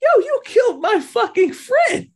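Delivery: high, loud outraged yell; angry shout. Retold or imitated. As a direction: scream it at him, furious. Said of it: "and she's like".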